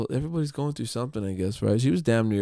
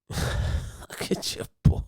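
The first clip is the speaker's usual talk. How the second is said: slow unvoiced whisper of the jeer; whispered tease; much slower than usual. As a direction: breathe the line slowly. Repeats the customer tease.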